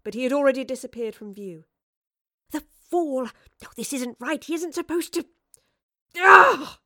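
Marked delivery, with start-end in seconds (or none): 2.5-5.3 high-pitched, agitated frustration; exasperated outburst. Acted. Professor frustrated as Marlowe runs off. 6.1-6.8 loud, high exasperated "ugh"; scream of frustration. A narrator's reading. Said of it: "She gave a scream of frustration".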